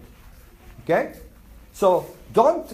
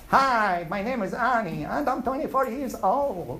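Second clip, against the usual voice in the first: higher-pitched Southern US drawl; Southern accent; 3 semitones higher than usual. Retold or imitated. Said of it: "Did you see them talking like this?".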